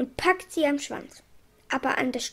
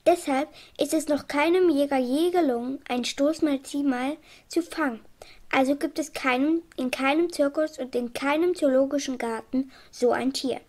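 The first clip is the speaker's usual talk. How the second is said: plain reading voice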